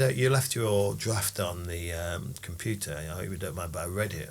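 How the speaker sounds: soft, hushed retelling of his words; imitating a quiet-spoken man; quiet imitation. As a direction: murmur it low like he does. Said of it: "He talks like that".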